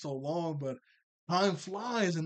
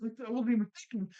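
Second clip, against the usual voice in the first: panicked rush; 7 semitones higher, much faster than usual. Retold or imitated. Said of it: "Valerie thinks to herself".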